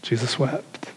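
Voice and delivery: hushed verse. Shown as a demonstration. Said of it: "every little kid's favorite Bible verse".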